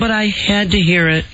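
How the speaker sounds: high whiny woman voice; Amber Fry voice. Acted. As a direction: whine it out like a clingy girlfriend. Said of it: "way too good at the whiny woman voice".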